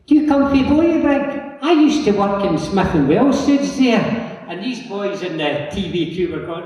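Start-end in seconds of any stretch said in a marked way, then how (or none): 0.1-4.3 high-pitched, loud mock excited stranger; excited passer-by. Stranger stops him in the street to ask where he's from, excited.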